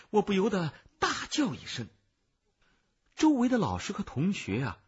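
plain reading voice

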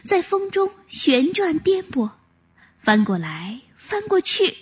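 ordinary delivery, nothing marked

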